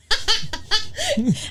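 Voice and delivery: high-pitched staccato laugh. Real, not acted. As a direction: crack up at your own story. Cracking up at own story.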